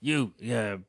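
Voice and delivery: lightly voiced bossy producer; Gus impression. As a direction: bark orders like a TV boss, barely changed. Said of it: "rate my Gus impression".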